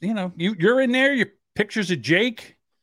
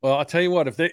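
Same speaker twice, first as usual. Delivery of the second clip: ordinary delivery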